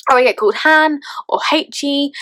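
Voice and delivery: usual speaking voice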